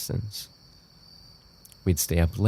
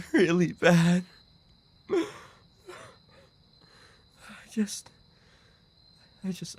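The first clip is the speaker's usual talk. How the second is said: high, broken and tearful; despairing sobbing; 12 semitones higher than usual. Acted. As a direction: plead with yourself through tears. Despairing self-blame after loss.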